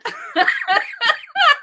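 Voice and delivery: loud high-pitched burst of laughter; real delighted laugh; big high laugh. Real, not acted. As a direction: let the laugh fly up high. Laughing at opium mix-up.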